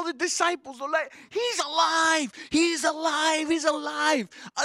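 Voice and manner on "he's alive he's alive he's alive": high, excited repeated cry; enthusiastic proclamation. Retold or imitated. Women running to tell the disciples, high excited shout. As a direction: burst out with the news as the women did. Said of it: "The enthusiasm".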